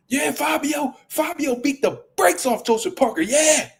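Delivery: mocking, high-pitched know-it-all voice; gloating Tim Bradley. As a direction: lampoon the pundit crowing he was right.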